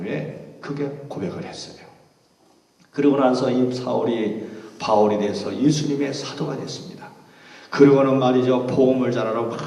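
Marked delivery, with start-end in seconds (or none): none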